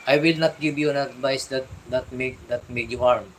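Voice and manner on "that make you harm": normal talking voice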